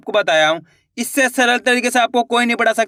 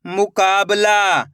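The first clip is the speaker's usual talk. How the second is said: slow, drawn-out blending of the word; slow clear reading; much slower than usual. Shown as a demonstration. Teacher to pupils, blending the word slowly. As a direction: stretch each syllable for the pupils. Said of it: "मैं किस तरीके से एक एक अक्षर का उच्चारण".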